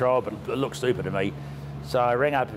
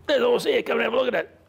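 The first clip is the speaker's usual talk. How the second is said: imitating Den in a much higher voice; old Den's voice; 11 semitones higher than usual. Den calling Rob over to see the horse, mimicked high. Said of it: "You got his voice down".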